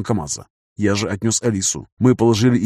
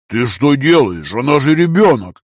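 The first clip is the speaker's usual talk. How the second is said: slow-starting protest growing louder; indignant, louder and drawn out at first; raised voice; 3 semitones higher, 5 dB louder, slower than usual. A narrator's reading. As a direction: raise your voice in disbelieving reproach. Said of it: "повышая голос".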